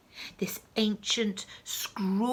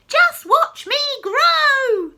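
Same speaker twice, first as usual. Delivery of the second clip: very high, loud and slow boast; crowing old crone; shouting; 14 dB louder, much slower than usual. Acted. Grandma boasting to George, loud and slow. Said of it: "she shouted".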